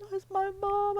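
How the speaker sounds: high-pitched tearful wail, re-enacted; mock tearful cry. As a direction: act out bursting into happy-sad tears. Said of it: "I start crying".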